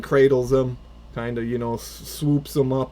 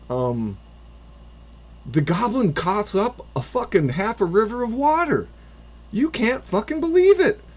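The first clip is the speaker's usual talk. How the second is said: excited narration; 7 semitones higher than usual. Acted. Game master tells the player the goblin lives, excited. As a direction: build the wonder as he starts breathing.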